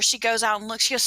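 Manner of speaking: ordinary delivery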